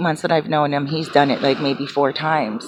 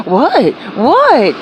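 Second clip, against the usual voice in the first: high, louder playful what; mock innocent what; 6 semitones higher, 4 dB louder than usual. Friend playing dumb when told to calm down, high and teasing. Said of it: "And he's like".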